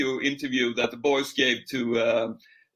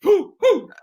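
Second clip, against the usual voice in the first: cheer; 7 dB louder than usual. Real, not acted. Cheer after singing the chant.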